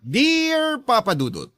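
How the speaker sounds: ordinary talk, nothing marked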